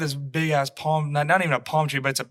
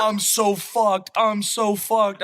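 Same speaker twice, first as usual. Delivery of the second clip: slow, higher drawn-out mock despair; mock lament; 5 semitones higher, much slower than usual. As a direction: imitate a doomed friend, dragging each word. Retold lament of grounded friend.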